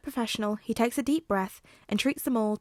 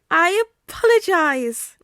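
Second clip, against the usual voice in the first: slow, polite apology from the principal; slow, higher voiced apology; higher, louder Nezu apology; 9 semitones higher, 9 dB louder, slower than usual. A narrator's reading. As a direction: apologize sweetly but a bit too loud.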